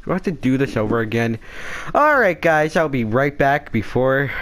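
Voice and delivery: ordinary talk, nothing marked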